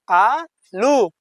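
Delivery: आलू said as plain aa-loo, not aa-ka; read as aalu. Shown as a demonstration. Teacher correcting pupils' reading of आलू, drawn out. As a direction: stretch आलू, long first आ, no extra का. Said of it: "आलू पूछेगा तो आ का मत पढ़िए".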